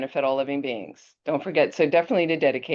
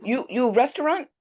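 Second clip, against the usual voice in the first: put-on Chinese accent; 9 semitones higher than usual. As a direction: imitate a heavy Chinese accent. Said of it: "a heavy Chinese accent".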